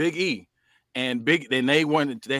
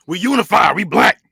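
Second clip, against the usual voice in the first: proud chant, much louder and higher; rally the crew like a slogan; mock rally cry; 4 semitones higher, 8 dB louder than usual. Rallying cry among friends.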